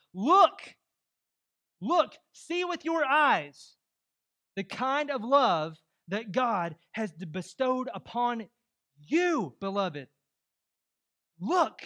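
loud, high, slow proclamation; emphatic preaching. Real, not acted. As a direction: hammer "Look" and stretch out each phrase. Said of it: "I'm being a little emphatic this morning".

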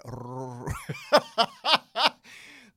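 loud comic rolled R breaking into laughter; rolled-tongue trill. Shown as a demonstration. Learner trying to roll the tongue in Spanish, loud comic trill into laughter. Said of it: "you gotta roll your tongue".

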